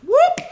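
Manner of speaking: very high, loud siren chirp; siren whoop. Retold or imitated. Cops surprise the photographers, piercing siren whoop. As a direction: imitate a sudden police whoop.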